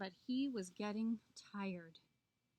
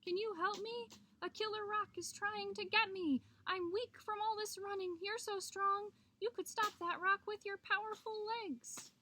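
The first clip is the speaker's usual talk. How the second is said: high, urgent storybook plea; pleading Coyote; 9 semitones higher than usual. A narrator's reading. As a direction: beg for help in a high voice. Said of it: "he called out".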